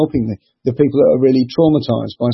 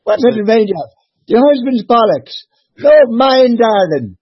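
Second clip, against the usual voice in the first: high falsetto gossiping woman, climbing higher; mock woman voice; 10 semitones higher, 4 dB louder than usual. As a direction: squeak it out like a cheeky old lady. Joke voicing the Irish women.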